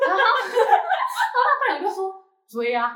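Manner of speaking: high, loud, giggling through the words; can barely get it out for laughing. Real, not acted. Laughing at friend's advice.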